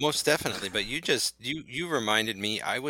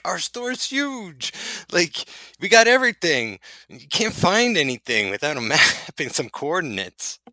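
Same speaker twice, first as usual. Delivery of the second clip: mock store voice; 6 semitones higher, 6 dB louder than usual. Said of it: "it's just kind of funny how it's like".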